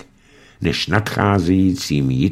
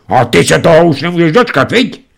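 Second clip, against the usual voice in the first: fast, high, raised outburst; explosive outburst; 9 semitones higher, 5 dB louder, faster than usual. A narrator's reading. Father exploding at his daughter, fast high anger. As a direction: blow up at the daughter. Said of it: "vybuchl Šarapatka otec".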